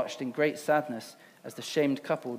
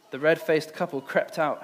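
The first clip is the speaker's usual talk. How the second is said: ordinary talk, nothing marked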